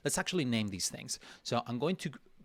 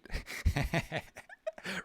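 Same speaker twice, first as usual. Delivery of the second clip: laugh; 8 semitones higher than usual. Real, not acted.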